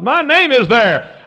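high, loud, stretched-out proclamation; shouted preaching. Real, not acted. Preacher rejoices over Book of Life, shouted preaching. Repeats the line at full force.

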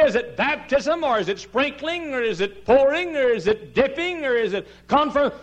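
high, loud string of questions; mock questioner. Preacher voicing a confused churchgoer, high and loud. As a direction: reel off the doubter's questions. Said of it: "You say".

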